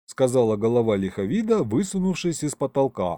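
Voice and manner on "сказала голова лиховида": ordinary delivery, nothing marked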